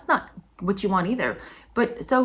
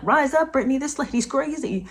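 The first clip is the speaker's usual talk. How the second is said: urging cheer; 7 semitones higher than usual. Retold or imitated. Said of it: "you're like".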